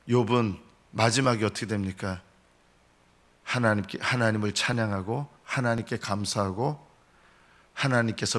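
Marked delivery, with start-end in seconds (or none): none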